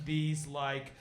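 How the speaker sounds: normal talking voice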